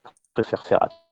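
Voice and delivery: like a bad phone line dropping out; radio breaking up. Real, not acted. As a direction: voice cutting out, breaking up. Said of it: "J'entends rien à ce que tu dis".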